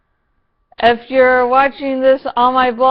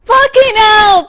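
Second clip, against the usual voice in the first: loud, higher exasperated curse; exasperated outburst; 10 semitones higher, 8 dB louder than usual. Real, not acted. Fan fed up with Lori, an exasperated loud curse. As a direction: vent your frustration out loud. Outraged at character.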